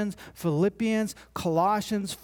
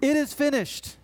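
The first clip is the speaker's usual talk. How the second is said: higher-pitched proclaimed cry; 9 semitones higher than usual. Retold or imitated. Preacher quotes crucified Jesus from the cross, crying out. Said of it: "cries out".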